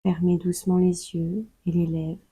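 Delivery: usual speaking voice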